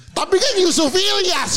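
high, drawn-out cry of disbelief; protes heran. Retold or imitated. Membantah temannya yang makan babi. (protes tak percaya, nada tinggi)

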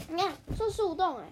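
plain reading voice

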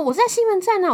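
high, loud, indignant reply; indignant retort. Retold or imitated. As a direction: throw it back like she is stupid. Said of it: "结果他说". (理直气壮地大声反驳)

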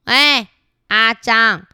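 loud, bossy demand; bullying shout. Acted. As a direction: bark it like a bully cornering him. 两只虫拦住阿张. (两只虫拦住阿张大声喝令)

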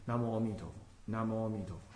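low, soft, even Buddha-name chant; quiet meditative chanting; gentle recitation. Shown as a demonstration. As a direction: repeat the name lightly and steadily. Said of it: "轻轻的提着".

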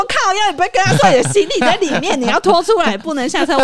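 loud, high angry scolding; mock furious shout; scolding yell. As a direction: yell at him as you did then. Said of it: "我真的超生气".

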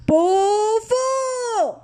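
very high, drawn-out yell; children shouting. Acted. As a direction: shout it with the whole family. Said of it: "哥哥和姐姐也加入爸爸大喊".

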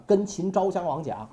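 plain reading voice

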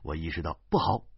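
ordinary delivery

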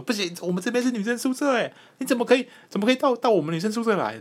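mock-furious high-pitched protest; angry neighbor girl. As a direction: squeal it like the outraged girl protecting her dorm. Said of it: "他就很，很生气".

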